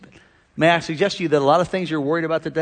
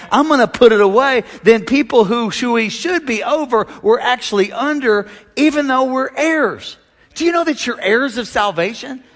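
louder, higher preaching build; 5 semitones higher, 4 dB louder than usual. Acted. Pastor rallying the congregation, preaching at full force. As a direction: climb toward the climax.

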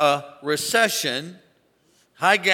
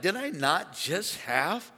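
softer, rising bewildered question; a hushed, slow, baffled king going higher; puzzled king; 5 semitones higher, 5 dB quieter, slower than usual. Retold or imitated. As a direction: wonder where the bagel went. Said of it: "The king is like".

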